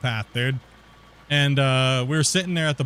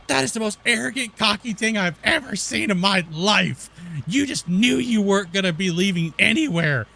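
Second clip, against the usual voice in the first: higher caricature of a scolding official; mock outraged officer; 6 semitones higher than usual. Border officer scolding him, higher mock outrage.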